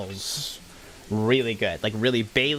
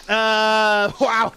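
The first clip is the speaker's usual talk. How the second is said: surprised wow; 9 semitones higher, 6 dB louder than usual. Real, not acted. Reacts to chat comment.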